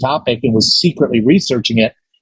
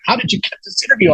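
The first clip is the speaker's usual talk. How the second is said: quick, higher mock amazement; salivating colleagues; 8 semitones higher, faster than usual. Envious station colleagues to the young interviewer, eager. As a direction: imitate eager coworkers in a rushed question. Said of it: "salivating. They're like".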